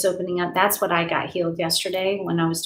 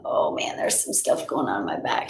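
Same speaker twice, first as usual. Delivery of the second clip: whisper; 10 semitones lower than usual. Real, not acted. Feeling energy in her back.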